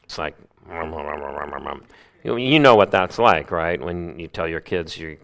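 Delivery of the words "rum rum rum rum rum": low, soft crowd rumble; low grumbling rumble. Shown as a demonstration. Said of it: "low rumbling sound".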